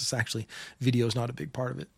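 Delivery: usual speaking voice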